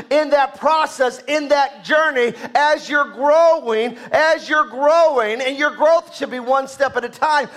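loud, rising sermon delivery; full-force preaching. Acted. Pastor urging the church to grow, preaching loud. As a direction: drive it home to the congregation.

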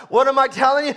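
ordinary talk

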